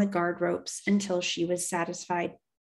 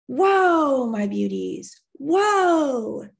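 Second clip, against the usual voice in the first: yelled whoa; 6 semitones higher, 6 dB louder, much slower than usual. Acted. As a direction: shout the team down, then coax them. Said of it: "yelled Charlotte".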